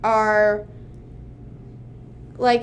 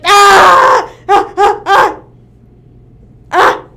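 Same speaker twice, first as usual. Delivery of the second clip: loud, high cartoon scream, then short yelps; mock screams in short, high bursts; Patrick's screaming; 7 semitones higher, 14 dB louder, much slower than usual. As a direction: scream like a panicking cartoon character. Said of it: "Patrick keeps screaming".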